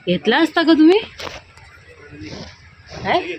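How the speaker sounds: teasing call. Real, not acted. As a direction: tease him, calling out. Teasing husband loudly.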